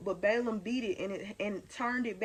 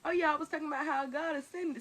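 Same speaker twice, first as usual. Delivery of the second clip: ordinary delivery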